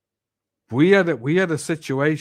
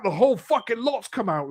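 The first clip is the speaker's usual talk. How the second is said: ordinary talk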